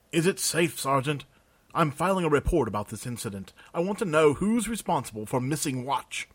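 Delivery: a high, prissy bureaucrat voice, indignant; fussy official. Acted. Pompous clerk Jurgen grilling the sergeant, high and indignant. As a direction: play a pompous clerk demanding answers.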